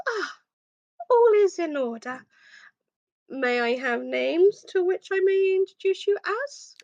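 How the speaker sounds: high, formal butler voice. Acted.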